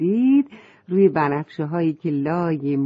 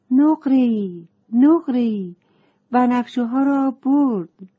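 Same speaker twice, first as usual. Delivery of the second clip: higher, lightly upset calling; a touch of dismay; mild sadness; 5 semitones higher than usual. A narrator's reading. Butterfly tells the girl the violets were lost, mildly upset. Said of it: "پروانه با ناراحتی گفت".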